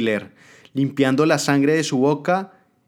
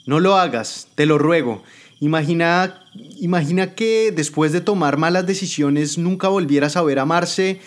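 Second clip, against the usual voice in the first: high and louder, desperate pleading; begging adviser; 6 semitones higher, 3 dB louder than usual. Acted. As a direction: beg for her life. Adviser begs for her life.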